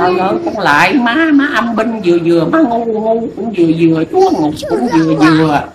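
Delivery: a quack seer chiding a silly client, fast and high; mock scolding. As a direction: lecture her like a fed-up fortune teller. Fortune teller scolding client.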